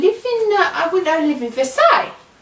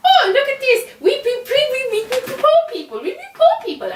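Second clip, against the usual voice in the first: high-pitched, gleeful play-acting; mock poor people; 10 semitones higher, much faster than usual. Queen imitating squat-mates playing poor, high and mocking. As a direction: rattle it off high, pretending to be poor. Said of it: "they're like".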